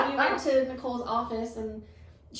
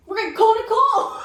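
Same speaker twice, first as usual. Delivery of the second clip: loud, high, gleeful declaration; laughing, hammed-up proclamation; excited announcement; 11 semitones higher, 9 dB louder than usual. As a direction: belt it out like the obvious big solution. Retelling own announced solution.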